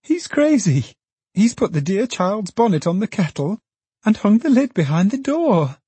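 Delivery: a daughter aghast at her father's muddle; startled exclaiming Meg. Acted.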